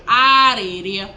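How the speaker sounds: "Areria" loud and stretched out; drawn-out R demonstration. Shown as a demonstration. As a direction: sound out "Areria" slowly, showing every R. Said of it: "There's a lot of R going on in that word".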